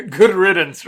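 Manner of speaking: laughed out loud and loud; a loud laughing good riddance. Real, not acted. Cohost jokes about the missing boy, laughing loudly.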